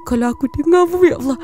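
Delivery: reacting to a ghost story, loud and high with fright; freaked out. Real, not acted. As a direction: blurt it out, truly creeped out. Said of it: "scary dia".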